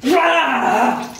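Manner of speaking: sudden cold scream; shocked scream from the cold. Real, not acted. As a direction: yelp at the icy splash. Ice water hits her.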